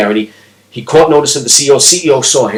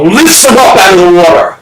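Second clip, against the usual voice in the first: high-pitched, urgent narration; breathless-rescue urgency, pitched up; intense storytelling; 6 semitones higher than usual. Acted. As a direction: drive the story to its climax. Story climax, kid pulled up gasping.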